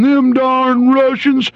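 high, slow mocking drawl of a clueless American. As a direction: imitate a dumb American cheering on war. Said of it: "they're going".

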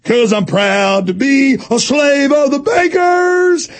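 high, slow, shouted mock patriot anthem; mocking obedient citizen. Host mocking listeners as proud slaves, slow ranting shout. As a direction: belt it out like a brainwashed flag-waver mocking them. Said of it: "and you'll go".

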